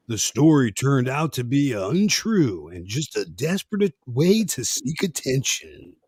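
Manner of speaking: slow, emphatic mock news read; deadpan news bulletin.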